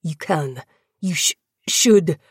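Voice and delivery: halting, stammered conviction; pained insistence. A narrator's reading. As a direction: push the words out earnestly despite pain. Tortured prisoner insisting with stammer.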